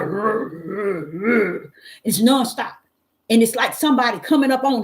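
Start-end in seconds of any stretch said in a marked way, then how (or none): 0.0-1.7 dog growl imitation.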